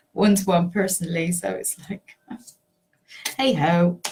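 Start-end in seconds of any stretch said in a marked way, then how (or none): none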